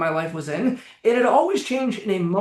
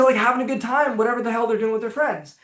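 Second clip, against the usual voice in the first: ordinary delivery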